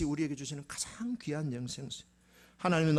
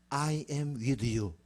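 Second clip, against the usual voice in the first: very slow, measured promise; solemn declaration; 2 semitones lower, much slower than usual. Acted. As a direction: draw each word out slowly and solemnly. God's promise, slowly declared. (아주 천천히 엄숙하게)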